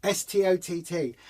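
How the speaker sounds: ordinary delivery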